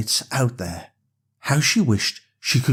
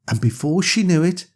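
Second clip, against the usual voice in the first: ordinary delivery, nothing marked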